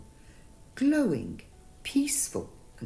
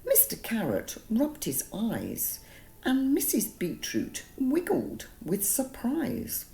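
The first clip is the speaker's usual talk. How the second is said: plain reading voice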